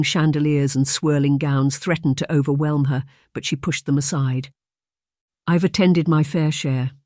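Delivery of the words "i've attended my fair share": ordinary delivery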